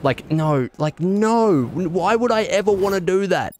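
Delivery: real exasperation, pitch jumping high; exasperated protest. Real, not acted. Streamer objects to the game, exasperated and high-pitched. Frustrated by bad game outcome.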